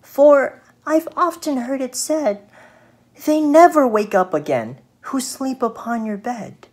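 storybook fly, high and firm; little fly refusing. Acted.

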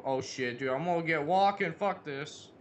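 ordinary delivery

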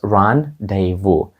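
rendezvous said slowly as "ran-day-voo"; silent letters. Shown as a demonstration. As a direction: draw out rendezvous with no z sound. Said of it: "багато літер з даного слова не вимовляється".

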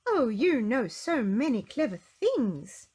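slow, loud, high exclamation; exclaiming. A narrator's reading. Mister Clever admires Little Miss Brainy, exclaiming slowly and loudly. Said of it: "exclaimed Mister Clever".

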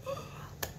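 a sharp, breathy intake of breath in delight; delighted gasp. Retold or imitated. Seeing handmade cards at Dollar Tree, a delighted gasp. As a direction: gasp like you just spotted treasure. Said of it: "I was like".

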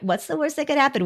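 cocky bravado. Retold or imitated. Host echoing cohost's daredevil line, quick mock bravado. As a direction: rattle it off like a reckless bro. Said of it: "Where he says".

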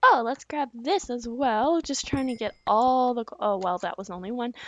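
normal talking voice

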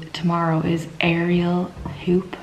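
hushed, slow whisper. Real, not acted. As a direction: breathe it out in a whisper. Dreaded activity, whispered aside.

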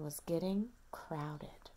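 whispered narration. A narrator's reading. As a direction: breathe the line like a secret. Story read to toddler.

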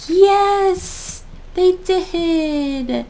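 loud happy cheer. Real, not acted. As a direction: celebrate out loud. Cheering over new pages.

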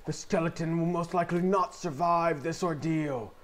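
hushed-then-rising wildlife narrator voice; nature documentary. Pretend. Skeleton in its natural habitat.

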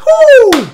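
very high, loud whoop. Real, not acted. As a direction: whoop out the shock. Release after jump scare.

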